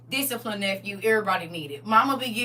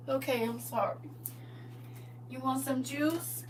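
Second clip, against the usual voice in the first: meek appeasing; 2 semitones higher, 12 dB quieter than usual. Acted. Child appeasing God.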